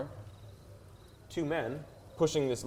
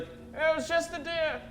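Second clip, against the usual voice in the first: high, slow, easy reassurance; a high mock guard voice; guard reassuring; slower than usual. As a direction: tell the other guard it's nothing. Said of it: "he's like".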